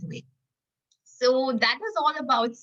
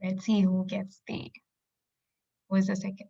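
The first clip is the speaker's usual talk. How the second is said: hushed suspense whisper. Real, not acted. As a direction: whisper it while waiting. Host waits for wheel result.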